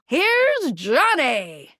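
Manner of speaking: very slow, stretched-out catchphrase; showy announcement. Acted. Game character introducing Johnny, hammed-up drawn-out announcement. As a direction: draw out each word like a show host's introduction.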